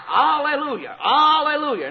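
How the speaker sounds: high ringing shout of praise; shouted alleluia. Retold or imitated. Preacher shows heaven shouting, high shouted alleluia. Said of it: "up in heaven they shout".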